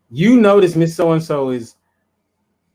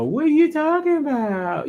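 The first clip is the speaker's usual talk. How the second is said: high mock innocence; 13 semitones higher than usual. Partner playing dumb.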